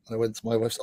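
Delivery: usual speaking voice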